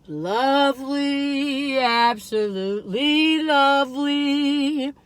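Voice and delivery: very slow, savoring each word; drawn out. Real, not acted. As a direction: stretch it out in bliss.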